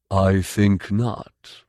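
very slow, deliberate; measured refusal. Acted. Tough calmly refusing the Guardian, slow. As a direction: deny it unhurried.

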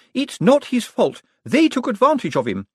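heated high accusation; accusatory. A narrator's reading. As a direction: leap to his defence, accusing the others. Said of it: "She pointed an accusatory finger".

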